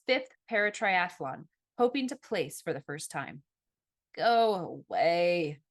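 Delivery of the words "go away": slow, drawn-out sleepy groan. A narrator's reading. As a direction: drag the words out, half asleep. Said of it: "I groaned".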